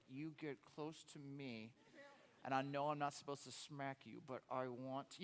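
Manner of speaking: low, soft mock threat; parent warning. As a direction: quietly warn the smirking kid. Parent warning smirking kid.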